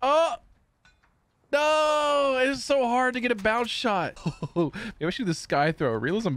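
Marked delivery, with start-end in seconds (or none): 0.0-4.2 frustrated outcry. Real, not acted. Player missing a bounce shot, high frustrated outcry. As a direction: cry out high at a near miss.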